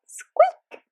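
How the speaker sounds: very high squeaky dolphin call; tiny high dolphin squeak. Retold or imitated. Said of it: "hearing them trying to speak".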